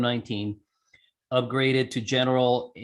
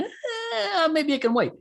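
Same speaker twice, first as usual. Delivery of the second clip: squeaky laughing reluctance, pitched way up; laughing high-pitched quote; slower than usual. Retold or imitated. Balking at radio prices, high laughing mock hesitation. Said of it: "I saw the prices of things and I went".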